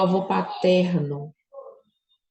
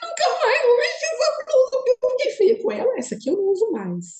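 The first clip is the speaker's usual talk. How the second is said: much higher, whimpering and teary, hammed-up; mock crying whine. Her past self after Alessandra dislikes her blouse, mock sobbing. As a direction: play her old self sobbing over a blouse, overdone. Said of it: "se ela tivesse falado que não pra mim há uns anos atrás".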